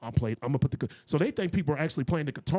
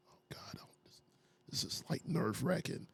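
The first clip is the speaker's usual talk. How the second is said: breathless whisper, sick with nerves; nervous whisper; 4 semitones higher, 9 dB quieter than usual. Retold or imitated. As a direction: whisper it like you're about to throw up from nerves. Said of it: "we were just sitting there like".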